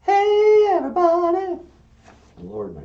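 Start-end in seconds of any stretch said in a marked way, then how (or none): 0.0-1.6 high, loud female puppet greeting; big high falsetto hello; standard female voice. Acted. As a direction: call out in a man's high female voice. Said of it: "female voice".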